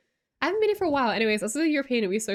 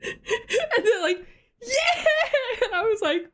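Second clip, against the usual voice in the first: laughing; 14 semitones higher, 4 dB louder, slower than usual. Real, not acted. Laughing while retelling the story.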